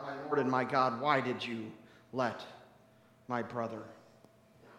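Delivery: low, hushed, halting anguish; pained cry. Acted. Mary weeping at Jesus' feet, pained. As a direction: plead softly, as if weeping in pain. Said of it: "She is in pain. She cries out".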